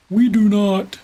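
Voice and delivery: loud mock-dramatic concession; emphatic correction. Real, not acted.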